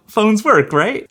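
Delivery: pleading with someone who just won't get it; exasperated rising right. Retold or imitated. Exasperated explaining to clueless buyer.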